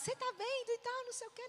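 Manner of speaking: high, mock nagging whine; indignant complaint, imitated. Wife griping to Marcos, high indignant whine. Replays her complaint to husband.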